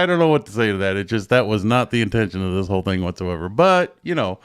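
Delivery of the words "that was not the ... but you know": usual speaking voice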